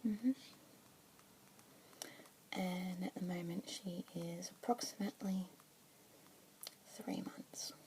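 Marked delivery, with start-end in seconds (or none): 2.5-7.7 soft unvoiced whisper throughout; asmr whisper. Keeper introducing a budgie to a listener, whispered. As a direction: whisper gently, close to the mic.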